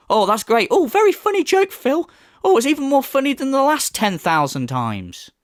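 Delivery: high-pitched, gushing fake delight; sarcastic praise. Critic pretends to love Phil's worn-out joke, sarcastically. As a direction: heap on mock amusement, way up high.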